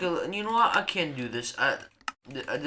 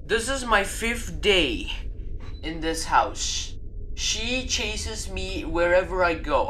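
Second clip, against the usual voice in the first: slow, read-aloud voice; reading a note; 6 semitones higher, slower than usual. Acted. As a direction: read the diary note slowly. Reads a note in-game.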